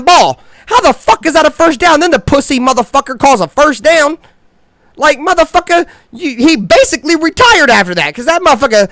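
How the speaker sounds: real indignant shouting; outraged rant. Real, not acted. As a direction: blow up over the bad call. Ranting about a bad referee call.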